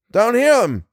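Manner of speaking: high shout back; yelled reply. A narrator's reading. Tom answers Bill over the gunfire, yelling. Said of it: "Tom yelled back".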